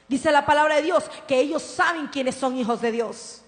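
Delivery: rising sermon cry; fiery preaching. Acted. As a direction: proclaim it to the congregation.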